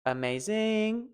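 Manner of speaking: slow "amazing" rising to a high ZING; stress on ZING. Shown as a demonstration. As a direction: misplace the stress, ama-ZIIING. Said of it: "that is incorrect".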